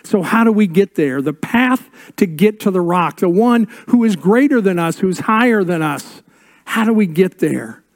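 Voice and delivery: rising sermon emphasis; preaching. Acted. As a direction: preach it out to the congregation.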